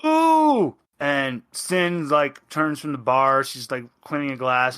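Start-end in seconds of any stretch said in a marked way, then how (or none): 0.0-0.8 high, loud delighted ooh; excited ooh. Real, not acted. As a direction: squeal it out with glee. GM reacts to player's offer.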